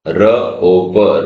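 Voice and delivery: र ओ प sounded very slowly, one sound at a time. Shown as a demonstration. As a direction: split रोप into र, ओ and प slowly. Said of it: "रोप". (र ओ प धीरे-धीरे अलग करके)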